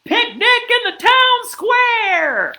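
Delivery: very high, loud, drawn-out shout; excited kid's yell. A narrator's reading. Ferguson kid calling the town to a picnic, yelled. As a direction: yell it out slowly across the whole crowd. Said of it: "one of the Ferguson kids yelled".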